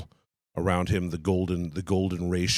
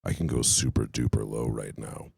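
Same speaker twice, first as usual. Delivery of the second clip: extremely deep bass voice; 10 semitones lower, 6 dB quieter than usual. Real, not acted. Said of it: "my voice is actually even lower".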